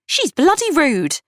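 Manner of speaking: high, drawn-out indignation; slow, high-pitched outrage at a rude girl; fuming. A narrator's reading. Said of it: "Kat fumed".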